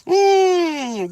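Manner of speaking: high, loud groan; pained groan. Retold or imitated. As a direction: groan in pain. Mimicking the pain.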